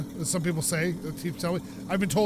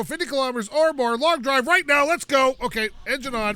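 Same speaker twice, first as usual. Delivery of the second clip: fast, higher and louder rallying cry; hyped kickoff; 6 semitones higher, 5 dB louder than usual. Acted. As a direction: pump up the crowd to start the run. Kicking off the drive.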